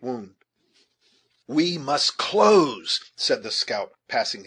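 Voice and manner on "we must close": slow, loud, raised rallying call; urgent shout to press forward. A narrator's reading. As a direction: draw it out as a high, forceful order. Scout urging friends on in chase.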